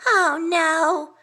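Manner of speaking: high alarmed squeak. A narrator's reading. Small worried hedgehog hearing bad news, high alarmed squeak. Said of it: "Quince squeaked".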